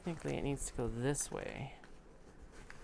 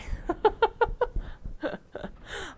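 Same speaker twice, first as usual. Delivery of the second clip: high giggle; 6 dB louder than usual. Real, not acted. Laughing at own mistake.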